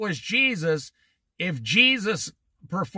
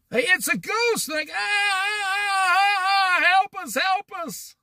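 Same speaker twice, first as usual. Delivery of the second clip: high-pitched wailing panic, hammed up; mock terrified screaming; 13 semitones higher than usual. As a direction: imitate terrified men screaming for help. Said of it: "They're yelling and screaming".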